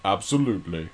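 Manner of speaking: lightly put-on British accent; Stuart. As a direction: answer as a mock British mascot. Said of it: "Can you do a British accent?".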